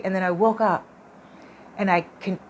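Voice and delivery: ordinary delivery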